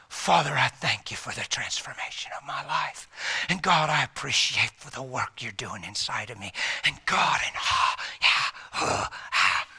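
hushed, whispered thanks to God; Paul praying while driving; soft prayer. Acted. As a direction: pray quietly from the heart.

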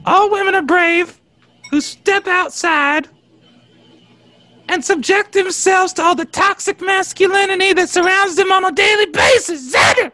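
high-pitched righteous tirade; mounting indignant rant. Acted. Defending brave women to co-host Xander, high indignant rant.

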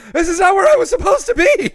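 high, fast, loud wail of panic; mock screaming. Podcast host joins in as stranded sperm, mock screaming. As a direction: shriek it like a doomed little creature. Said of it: "They're all just screaming".

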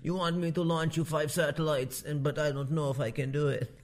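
mock tycoon as mafia boss; Ambani. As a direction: play a reluctant don granting favours, Indian-accented. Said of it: "I don't know if that's how Ambani talks".

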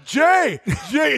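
imitated high-pitched holler; mock shout after someone walking off; calling out. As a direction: holler the name like an eager fan. Buddy calling out after Jay.

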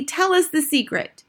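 high, eager plea; impatient cry. A narrator's reading. Witch audience demanding the secret, impatient cry. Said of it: "cried the audience impatiently".

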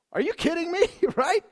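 high, loud, laughing disbelief; thrilled incredulous laugh; amazed laugh. Real, not acted. Preacher marvels at praying prisoners.

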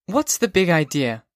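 put-on high, sharp girl's voice; a girl woken by a drunk caller; young woman Sally. A narrator's reading. Sally answers drunk Holden, annoyed.

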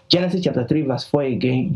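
ordinary delivery

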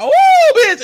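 very high, loud gasp of surprise; hammed-up squeal of shock; shocked shriek. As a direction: shriek it like you can't believe who walked in. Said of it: "'cause they're like".

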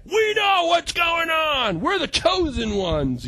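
high, slow smug proclamation; mock the know-it-all churchgoer; self-righteous. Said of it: "Christians like that".

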